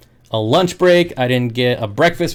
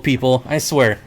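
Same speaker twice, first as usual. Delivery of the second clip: ordinary delivery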